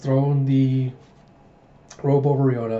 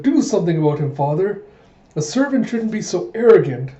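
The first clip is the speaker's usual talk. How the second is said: raised-pitch princess outburst; indignant complaint; 5 semitones higher, 4 dB louder than usual. Acted. Princess complaining to her father about a servant, indignant. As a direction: protest louder and higher toward the end.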